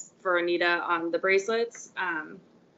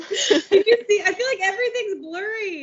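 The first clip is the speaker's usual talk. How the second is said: laughing; 11 semitones higher, 4 dB louder than usual. Real, not acted. Laughing at blurry camera.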